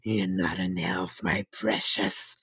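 soft, low whispered Smeagol. Acted. Host voicing Smeagol muttering to himself in a whisper. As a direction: breathe it to yourself like Smeagol.

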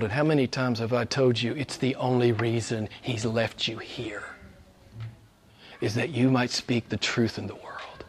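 drops to a whisper; hushed. Real, not acted. Preacher confiding in congregation, whispered reminder. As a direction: lean in and whisper it.

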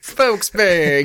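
high, loud peppy cheer; cheerleader. Pretend. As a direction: yell it like a cheer squad. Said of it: "like a cheerleader".

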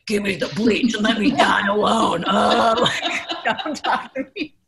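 hammed-up shout, breaking into laughs; mock monster snarl. Retelling snapping at her date while sick, mock shout. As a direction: play a dramatic wreck snapping at him. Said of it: "horrible monster that was like".

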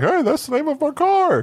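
Seinfeld impression. Imitating Seinfeld as Jerry.

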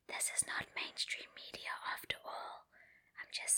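soft conspiratorial whisper; whispered aside. Real, not acted.

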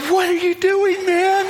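high, baffled "what are you doing, man"; lightly hammed-up bewildered friend; high puzzled guy. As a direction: pitch it up like a confused bystander. Guy's puzzled look voiced.

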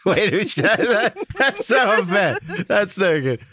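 high, slow, laughing protest; laughing outrage. Real, not acted. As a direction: cry foul while cracking up.

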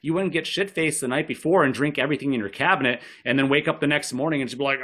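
ordinary delivery

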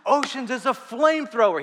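loud, high-pitched gushing outburst; imitated ecstatic raving, way up high and loud; freaking out. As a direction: freak out over a favorite song, imitating a fan. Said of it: "He's just freaking out".

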